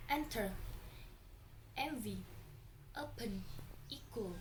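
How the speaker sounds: "enter," "envy," "open," "equal," first syllable strong, second short; first-syllable stress. Shown as a demonstration. Teacher showing short final syllables in "enter," "envy," "open," "equal," first-syllable stress. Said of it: "two syllable word with stress first".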